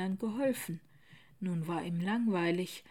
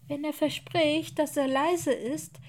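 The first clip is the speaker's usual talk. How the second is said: kleines Mädchen; 7 semitones higher, 5 dB louder than usual. Acted.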